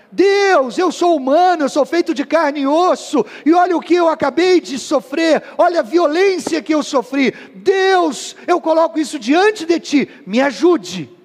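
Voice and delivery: desperate shouted prayer; impassioned prayer. Retold or imitated. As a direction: cry out your hurt to God. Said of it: "Isso é confessar".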